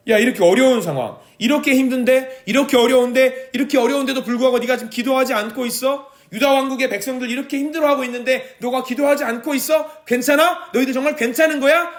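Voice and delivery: high, rising challenge voiced as God; God confronting the people who do not pray, high and urgent; God challenging. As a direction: press them hard, are you really fine. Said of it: "하나님이 오히려 우리한테 물어보고".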